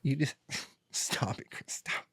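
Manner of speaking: high squeal sinking into whispery laughter; mock giggly gushing. Speaker mimics the mother turned friendly in the house, mock gushing. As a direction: mimic someone tickled, high then breathless.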